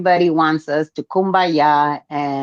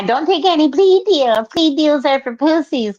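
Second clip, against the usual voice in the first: raised mocking voice; mocking quote; 9 semitones higher than usual. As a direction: mimic his bragging advice. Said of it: "who you constantly said".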